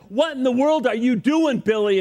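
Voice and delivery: high-pitched retold yell; mock yell. Ballplayer confronts friend holding rifle, mock yell. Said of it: "Mantle yells".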